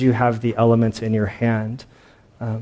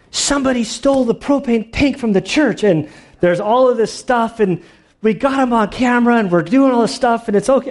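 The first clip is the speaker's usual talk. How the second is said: high, rushed, flustered retelling of her texts; much higher, hurried flustered quote; flustered Melanie; 11 semitones higher than usual. Retold or imitated. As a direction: play the wife all worked up but insisting it's fine. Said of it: "a righteous indignation, a being flustered".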